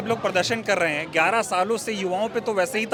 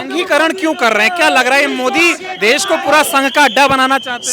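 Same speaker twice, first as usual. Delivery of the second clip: loud, higher-pitched reporter question; raised voice, pressing for an answer; 4 semitones higher, 7 dB louder than usual. Real, not acted. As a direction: push the question out over the protest noise. Reporter questions over protest noise.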